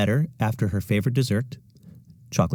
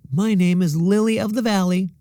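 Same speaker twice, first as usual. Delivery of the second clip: much higher, louder, showing off; proud classmate; 10 semitones higher, 5 dB louder than usual. A narrator's reading. Victoria claiming a flower name, proud. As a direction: announce it proudly.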